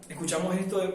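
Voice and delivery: normal talking voice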